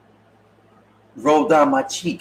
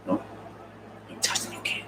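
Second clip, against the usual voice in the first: whisper; 15 dB quieter, faster than usual. Acted. Secret reveal whispered.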